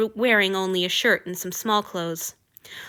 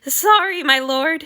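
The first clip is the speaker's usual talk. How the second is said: rasped; 7 semitones higher, 5 dB louder, slower than usual. A narrator's reading. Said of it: "he rasped".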